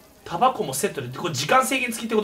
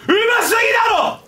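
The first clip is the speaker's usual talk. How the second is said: ecstatic shout; 11 semitones higher, 5 dB louder than usual. Real, not acted. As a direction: scream praise for yourself. Praising his own cooking skill.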